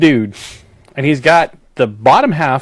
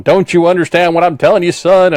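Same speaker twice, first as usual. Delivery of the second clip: mock angry; 6 semitones higher, 4 dB louder than usual. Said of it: "he starts getting really mad".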